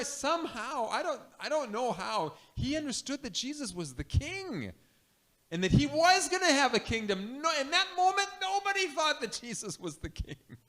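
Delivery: usual speaking voice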